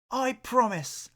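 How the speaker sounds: high, smiling and gentle; smiling promise. Acted. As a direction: promise it with a smile.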